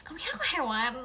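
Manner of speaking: a first mock-offended question; indignant. Real, not acted. Asking the viewer who called her tame, mildly indignant. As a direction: ask it half-offended. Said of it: "yang ini jinak sih".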